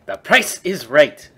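loud, slow quoting of the line; mock catchphrase quote. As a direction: savor the catchphrase slowly. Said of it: "God damn quote".

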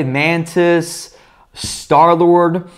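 usual speaking voice

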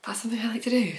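a sheepish whisper to the listeners; whispered confession. Real, not acted. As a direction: breathe it as a quiet whisper. Confessional aside about yarn thoughts.

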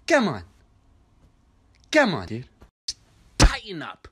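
stern scolding elder. Acted. Scolding Michael over his dirty song, stern. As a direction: scold him like a disappointed uncle.

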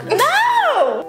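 put-on high, loud toddler refusal; bratty baby shout. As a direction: yell it like a cranky toddler. Acting as a baby.

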